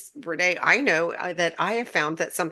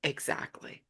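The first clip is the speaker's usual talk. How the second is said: whispered exactly; 3 semitones lower, 12 dB quieter, slower than usual. Real, not acted. Whispered agreement.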